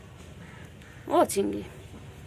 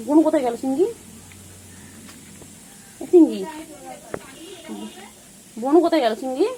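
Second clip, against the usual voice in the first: loud, high sing-song calling to the pet; 9 semitones higher, 6 dB louder than usual. Real, not acted. Owner asking the pet where its sister went, loud high calling. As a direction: call across the room in a bright, carrying voice.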